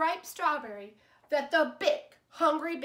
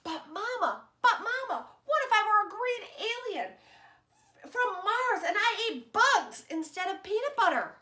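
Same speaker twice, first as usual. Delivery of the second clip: put-on higher, louder kid with a laugh; giggling eager child; 8 semitones higher, 3 dB louder than usual. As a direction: plead with Mama through giggles.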